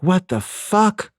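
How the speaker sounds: high, drawn-out stunned reaction. Retold or imitated. Girlfriend to the boyfriend who just hit her, stunned. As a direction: imitate someone stunned, stretching out the words high. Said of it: "stunned that I hit her that hard".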